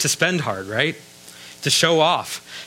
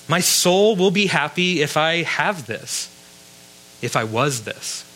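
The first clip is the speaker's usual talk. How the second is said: usual speaking voice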